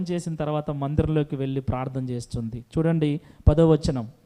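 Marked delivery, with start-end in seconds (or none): none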